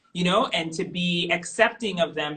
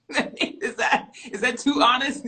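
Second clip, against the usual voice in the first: laughing; 5 semitones higher, 4 dB louder than usual. Real, not acted. Laughing at her own confession.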